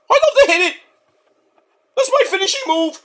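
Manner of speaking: high, put-on skit voice; put-on character voice. Skit character bragging about his move in a high voice. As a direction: squeak it out like a cartoon brawler.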